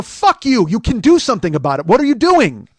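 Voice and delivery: loud, high outburst at an official; acted-out shouting rage; furious retold yell. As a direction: yell it in outrage. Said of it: "so I yelled".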